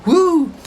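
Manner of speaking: a sudden high-pitched whoop of joy; high excited whoop. Real, not acted. Vlogger whooping at a buy signal on the chart. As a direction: whoop like you just won.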